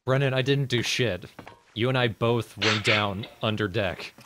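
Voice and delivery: indignant outburst. Real, not acted. Protesting innocence to teammate.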